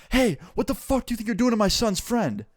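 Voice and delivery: high-pitched protective mom confronting bullies. Retold or imitated. Mom to kids shoving her son's friend, high-pitched confrontation.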